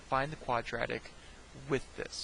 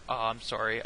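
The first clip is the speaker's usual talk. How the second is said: ordinary talk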